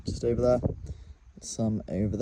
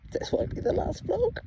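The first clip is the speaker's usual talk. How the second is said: whispered aside about the cows; hushed so the cows won't hear; nervous whisper near the tent. Real, not acted. Cows close, camping alone.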